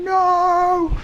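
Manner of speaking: high, sharp mock shriek of panic; panicked no. Host recalling the setup chaos, a high shrieked no. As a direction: yelp it like everything just went wrong. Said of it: "I've been going".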